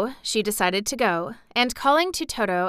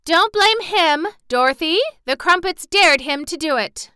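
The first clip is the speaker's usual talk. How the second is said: a hen calling down from a rooftop; 9 semitones higher, 7 dB louder than usual. Acted. Billina calls to Dorothy from the rooftop, high and loud. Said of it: "called out".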